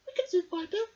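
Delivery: put-on high, loud crackly old woman voice; a wise old sister musing; old Myrtle. A narrator's reading. Myrtle talking to her brother, high and crackly. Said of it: "she said".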